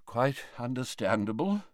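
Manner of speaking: soft, faint murmur. A narrator's reading. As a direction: barely voice it, exhausted. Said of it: "murmured Dumbledore".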